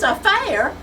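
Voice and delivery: high, loud giggling through the words; giggly son teasing the secretary, laughing high. Acted. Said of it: "Sonny is really giggly now".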